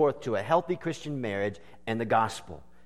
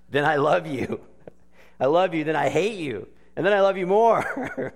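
higher, louder, half-laughing recital; laughing through lyrics; 4 semitones higher, 5 dB louder than usual. Acted. Preacher laughing while quoting the silly duet. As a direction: let the giggles break through the words. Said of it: "Sounds like the Franzone house".

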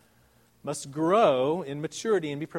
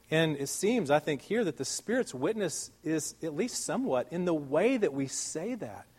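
ordinary delivery

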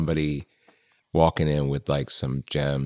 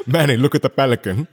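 laughing loud and high; cracking up while pointing out the pelican; 8 semitones higher, 7 dB louder, much faster than usual. Real, not acted. Friend laughing at the pelican image, loud and high.

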